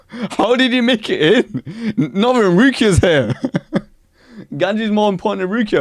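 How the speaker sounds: high-pitched, incredulous laughing outburst; laughing disbelief. Real, not acted. Streamer reacting to Ganju making the cut, laughing in disbelief. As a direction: laugh through it in amazement.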